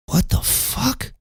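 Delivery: slow, high, hushed disbelief; flabbergasted. Retold or imitated. Teller re-enacts learning her old job, flabbergasted. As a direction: drag it out softly in a high voice.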